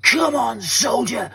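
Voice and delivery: high-pitched re-enacted army-style shout; retold shout. Rescuer rousing a trapped old soldier, retold shout. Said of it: "And I, I shouted".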